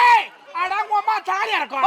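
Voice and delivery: high, scolding shout; acted angry outburst. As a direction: lash out in a comic family quarrel.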